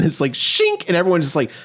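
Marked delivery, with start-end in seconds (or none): 0.3-0.9 high sharp shink of metal claws; blade shink sound. Retold or imitated. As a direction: make the claw-blade noise. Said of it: "shoots out like Logan's claws".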